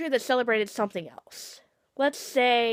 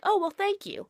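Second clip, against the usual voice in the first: polite thanks; 8 semitones higher than usual. Retold or imitated. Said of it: "I'm gonna get the sentiment and be like".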